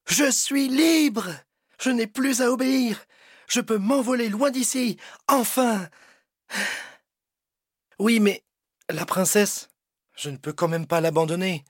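high, thrilled dragon shouting freedom; joyful cry. Acted. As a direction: burst out with relief and joy. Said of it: "Le dragon s'écrie".

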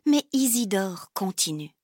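ordinary delivery

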